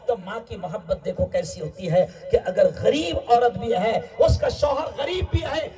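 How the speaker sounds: usual speaking voice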